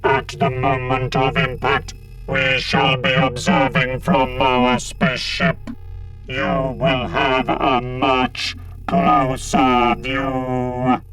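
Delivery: very slow electronic monotone; loud, buzzing synthetic drone; gloating robot leader. A narrator's reading. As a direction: drag out the threat mechanically. Cyber Leader gloats to Sarah.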